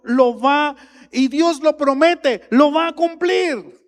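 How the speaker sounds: loud, slow, high preaching of God's oath; preaching at full force. Acted. Pastor proclaims that God swore by himself, fiery preaching. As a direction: thunder the promise to the congregation.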